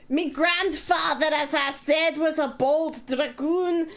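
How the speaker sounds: slow, hammed-up Scottish brogue; mock Scottish accent. Streamer reading the story aloud for Blake, slow hammed-up Scottish accent. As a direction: read it like a put-on Scottish storyteller. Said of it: "Do you want me to do it in Leeds accent".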